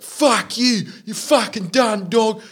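high-pitched mock yelling; put-on furious bloke, pitched high; imitated angry customer. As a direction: hurl hammed-up abuse while being thrown out. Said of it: "He's just like".

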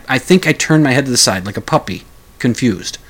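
ordinary talk, nothing marked

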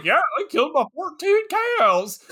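lightly mocking yokel farmer; dopey farmer. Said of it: "and he's like".

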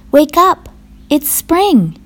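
high, eager wake-up call; shouted. A narrator's reading. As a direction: rouse a sleeper with a loud, high call. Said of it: "shouted Frog".

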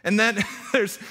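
breaking into giggles mid-sentence; can't hold back a giggle; giggling. Real, not acted. Preacher giggling before reading the line. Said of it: "I knew I was gonna giggle".

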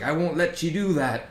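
a hint of a high little leprechaun. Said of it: "you can hear the leprechaun voices".

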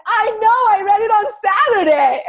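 the doctor admits it through laughter; laughing doctor. Retold or imitated. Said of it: "He's cracking up laughing".

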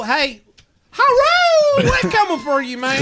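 high yelled chapter name, then a rough Southern holler; hound's war cry howled at the enemy; battle cry. Acted. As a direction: howl it like charging into battle. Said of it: "as well we say when we run into battle".

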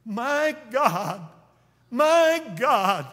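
preacher voicing Jesus' cry on the cross. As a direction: call out to God in agony. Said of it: "he cried".

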